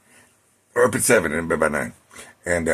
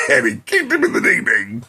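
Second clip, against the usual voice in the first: laughing; 4 dB louder than usual. Real, not acted. Laughing at the punchline.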